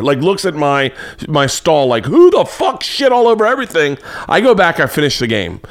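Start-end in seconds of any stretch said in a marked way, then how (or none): 2.1-4.0 much higher-pitched mock outrage; acted-out indignant yell; angry outburst. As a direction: demand the culprit, voice shooting up. Angry man discovering soiled stall.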